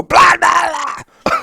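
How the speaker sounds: high, loud mocking blah blah; sudden snarling retort, high and loud; nasty snap. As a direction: bite her head off with a harsh blah blah blah. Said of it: "Why did you talk like that?".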